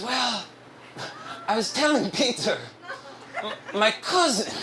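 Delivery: high, halting coworker impression; hesitant, high imitation of a coworker; Ricardo. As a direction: begin sheepishly in his put-on voice, with long pauses. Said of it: "I'm gonna do his voice".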